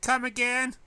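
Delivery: high loud come again; squeaky stunned disbelief; incredulous. Acted. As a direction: do a double take out loud. Jerks back in surprise.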